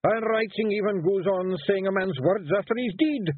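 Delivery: high, Scottish Feegle warrior protesting; high Scots pixie voice wheedling his way out. A narrator's reading. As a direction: plead like a small nervous Scottish warrior. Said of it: "said Rob Anybody".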